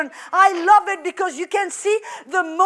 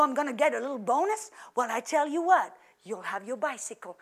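a gently voiced father, much softer and a little lower; tender daddy; 3 semitones lower, 9 dB quieter than usual. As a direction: soothe your little boy like a loving dad. Daddy answers his little boy.

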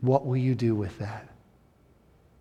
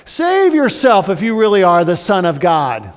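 louder, scornful jeer; mocking taunt; 5 semitones higher, 9 dB louder than usual. Roman guards mock the dying Jesus, loud and scornful. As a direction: sneer it like a guard. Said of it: "taunting and mocking Jesus".

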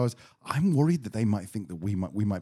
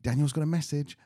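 lightly imitated friend on video; a hint of a higher, cheery greeting voice; Natalie; 4 semitones higher than usual. Friend starting a video message, lightly imitated greeting. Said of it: "started with Natalie going".

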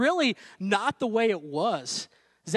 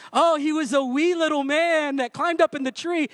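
mock sweet storytelling; 6 semitones higher than usual. Preacher mocking the cute children's version, sing-song. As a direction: sneer at the sweet Sunday school picture. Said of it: "we think of Zacchaeus".

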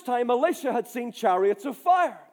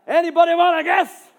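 loud, quick, high challenge to the crowd; punchy, raised-voice audience prompt; calling out; 5 semitones higher, 8 dB louder, faster than usual. Real, not acted. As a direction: throw the question out loudly. Calls out to the audience.

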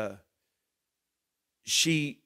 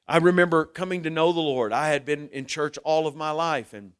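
normal talking voice

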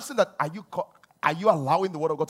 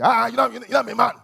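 proud manly boast; 4 semitones higher, 9 dB louder, faster than usual. Retold or imitated. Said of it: "You say".

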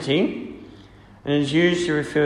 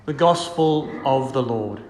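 solemn liturgical reading voice; gospel reading. Acted. Closing acclamation.

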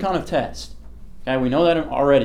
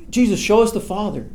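a pleading disciple, voiced higher; Philip pleading; 8 semitones higher than usual. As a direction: ask it like a pleading, eager disciple. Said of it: "And then Philip says".

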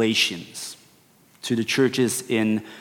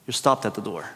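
soft echo of the previous line; quiet echo; 5 semitones lower, 6 dB quieter, faster than usual. Real, not acted. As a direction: repeat it much softer, lower.

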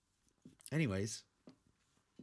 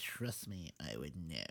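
much lower, softer mimic of her words; mocking echo; 6 semitones lower, 10 dB quieter than usual. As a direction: parrot her back in a dopey low voice. Mocking the co-host's line.

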